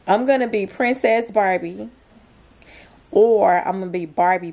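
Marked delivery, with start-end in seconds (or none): none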